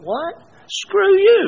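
high loud what then screw you; hammed-up angry retort; mock angry. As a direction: snap back in indignant disbelief. Angry reply to friend.

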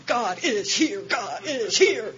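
high, loud, joyful proclamation with a laugh; neon sign announcement. Retold or imitated. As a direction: slowly trumpet it like a sign lighting up. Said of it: "like a big neon sign".

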